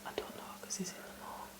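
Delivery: quick hushed whisper. Real, not acted. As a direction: whisper fast, barely voiced. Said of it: "Why did you whisper?".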